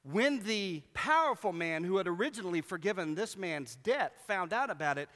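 normal talking voice

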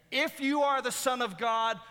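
high, loud taunt at normal speed; mocking challenge. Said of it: "this mocking statement".

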